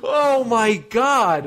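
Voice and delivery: slow, high amazed exclaim. Real, not acted. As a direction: stretch it out in disbelief. Amazed at matching searches.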